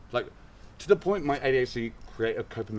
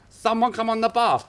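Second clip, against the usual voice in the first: mock manager call; 13 semitones higher, 7 dB louder than usual. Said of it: "he's like".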